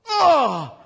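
sudden high yelp of pain; pained cry. Retold or imitated. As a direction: cry out as if struck hard. Mock cry of pain, retold.

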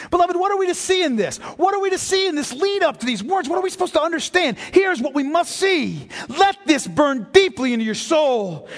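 preacher urging the congregation to see Christ's choice; impassioned preaching. Acted. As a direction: drive it into their souls. Sermon climax.

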